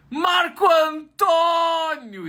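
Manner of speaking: very high, loud, slow shout of his name; elated shout. Acted. Superintendent greets intern.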